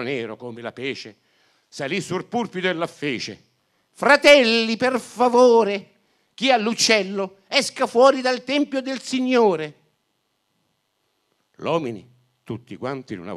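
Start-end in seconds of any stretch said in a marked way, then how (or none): 3.9-9.8 loud, high pulpit voice, per favore drawn out slowly; stern priest preaching. Acted. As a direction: priest booming at the congregation from the pulpit.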